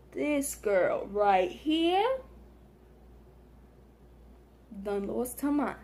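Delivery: slow, drawn-out disbelief; inner thought. Retold or imitated. Thinking about the rude girl, slow disbelief. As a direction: drag it out like an inner eye-roll. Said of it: "That's what I was saying in my head".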